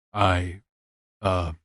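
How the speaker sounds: hesitant, a touch of awkwardness; awkward. Acted. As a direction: trail off, mildly unsure. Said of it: "his voice was awkward".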